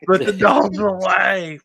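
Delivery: hammed-up football fan shout; mimicking the shouting Steelers fan. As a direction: echo the fan's rallying cry at volume. Joins in mimicking the clip.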